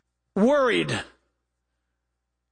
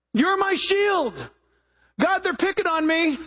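mock whiny plea; 8 semitones higher than usual.

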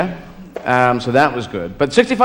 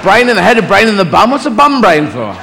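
baffled son, pitched up; Jesus questioning Dad; 3 semitones higher than usual. Acted. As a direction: fire puzzled questions at Dad, getting more insistent. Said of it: "Jesus must have asked a few questions like".

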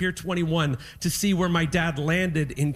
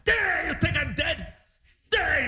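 high, drawn-out grumpy squawk; mock mad; 7 semitones higher, slower than usual. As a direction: imitate an indignant old father protesting. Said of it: "he was so mad".

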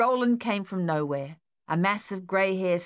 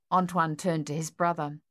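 ordinary delivery

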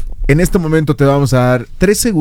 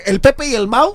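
imitating Mexican slang; 7 semitones higher than usual. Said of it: "hasta casi le faltó decir".

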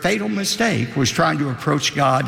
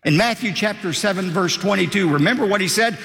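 ordinary talk

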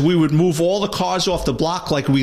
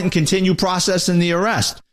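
usual speaking voice

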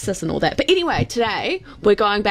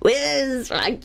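mock Scottish; 3 semitones higher, much slower than usual.

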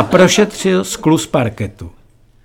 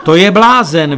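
higher, louder outburst; crying out; 4 semitones higher, 6 dB louder than usual. A narrator's reading. Young lady in front of the wild dancer, crying out. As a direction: exclaim it as a startled dancer would. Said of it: "vykřikla jedna slečna přede mnou".

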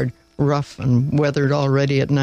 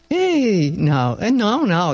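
a sharp, higher protest; expostulating; 5 semitones higher, slower than usual. Acted. Father rebukes his son before the abbot, sharp protest. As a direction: cut the lad off in protest. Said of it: "expostulated his father".

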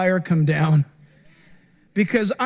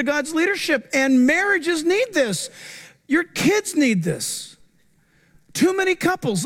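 raised, pressing sermon to the church; preaching at full force; 7 semitones higher than usual. Acted. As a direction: urge them not to quit.

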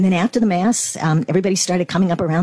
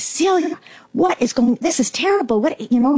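higher, urgent retold alarm at her friend; 7 semitones higher than usual. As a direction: sound worried, like something's gone wrong.

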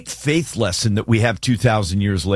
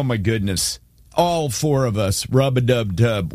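usual speaking voice

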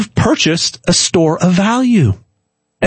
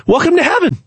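hammed-up Southern accent welcoming to heaven; mock Southern accent; 9 semitones higher than usual. Telling viewers how a Southerner greets them at heaven's gate, mock Southern accent. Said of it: "You might have a Southern accent".

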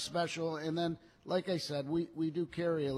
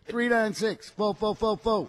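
rattled off loud; 6 semitones higher, 6 dB louder than usual. Shown as a demonstration. Cohost rattles off the dealership number for listeners, loud and quick. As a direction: rattle off the number, louder and higher. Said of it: "Just rattle it off".

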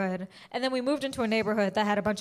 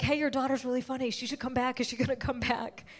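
softer, offhand imitation of the MC; casual MC aside; 7 dB quieter than usual. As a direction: toss it off softly to the parents. MC casually praises her to parents.

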